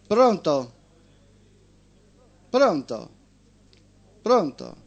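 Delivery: steady radio storytelling voice; host reading a short story on air; story narration. Acted. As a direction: read it like a tale to the listeners. Host reads a story aloud.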